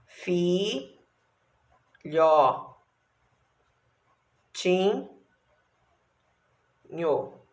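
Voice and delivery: fi, lhó, tim, nho with long gaps; syllable by syllable. Shown as a demonstration. Teacher dictating as pupils write, syllable by syllable. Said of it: "Aí, você vai escrever aqui".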